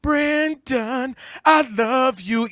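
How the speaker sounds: high, mocking mimicry of a sappy ballad; loud, exaggerated lovesick crooning parody. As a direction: draw it out in mock-sentimental devotion. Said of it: "[name] talking about his son, like".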